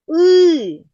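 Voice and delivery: И sung out long and loud; isolated vowel held. Shown as a demonstration. Teacher showing И can be sung, long held vowel. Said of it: "Спробуємо проспівати цей звук".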